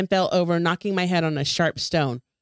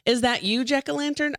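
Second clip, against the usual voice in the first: bright, higher jovial call across the marsh; called jovially; 7 semitones higher than usual. A narrator's reading. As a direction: call out cheerfully into the fog. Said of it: "I called jovially".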